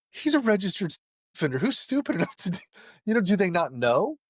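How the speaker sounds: can't believe what he's hearing; high, fast, stunned retelling; incredulous. Retold or imitated. Retelling his shocked reaction.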